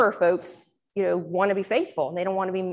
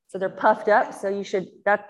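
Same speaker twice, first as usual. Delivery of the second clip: normal talking voice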